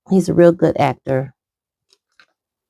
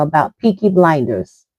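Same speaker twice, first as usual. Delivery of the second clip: ordinary talk, nothing marked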